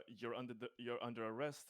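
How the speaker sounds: ordinary talk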